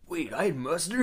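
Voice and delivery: surprised Otto. Retold or imitated. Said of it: "And he's like".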